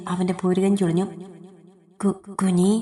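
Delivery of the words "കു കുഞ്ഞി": usual speaking voice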